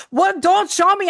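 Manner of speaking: a doctor pretending to be spooked; mock scared. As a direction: recoil like he's afraid to look. Doctor recoils from the photo.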